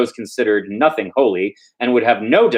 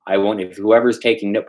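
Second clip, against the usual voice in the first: ordinary delivery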